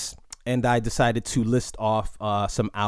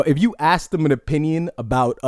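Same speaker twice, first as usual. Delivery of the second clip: ordinary talk, nothing marked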